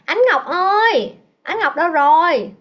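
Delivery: loud, drawn-out call for a student. Real, not acted. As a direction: call her name high and slow. Calling a missing student in class. (gọi to, kéo dài giọng)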